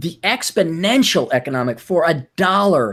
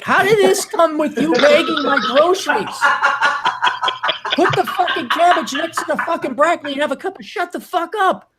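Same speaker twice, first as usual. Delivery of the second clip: much higher, exasperated disbelief with a laugh; incredulous outburst; 12 semitones higher than usual. Retold or imitated. Telling friends about the bag boy, mock outraged and high. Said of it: "I'm like".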